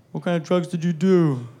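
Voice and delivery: lightly voiced little claymation person; claymation kid voice. Sons quizzing their dad, lightly voiced claymation kid. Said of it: "That's how they talk, like little fucking claymation people".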